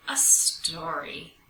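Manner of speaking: usual speaking voice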